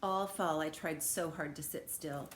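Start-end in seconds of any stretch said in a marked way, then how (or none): none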